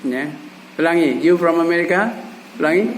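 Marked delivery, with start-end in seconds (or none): 1.2-2.1 "You from America?" rising like a question; statement words "You from America?" with question intonation; questioning tune. Shown as a demonstration. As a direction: lift the end of "You from America?" to ask it. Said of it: "ucapannya ucapan bertanya".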